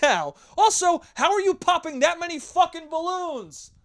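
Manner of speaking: high, loud, fed-up scolding; exasperated clown rant. Retold or imitated. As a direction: rant at the kid like an annoyed clown. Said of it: "I'm like".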